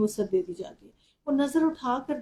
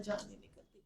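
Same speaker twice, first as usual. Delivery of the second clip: whispered; 15 dB quieter than usual. Acted. Dramatic sermon, unvoiced line.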